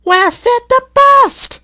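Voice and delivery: very high, loud, slow wailing coach; crying out. A narrator's reading. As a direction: coaches complaining they are overfull. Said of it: "they cried".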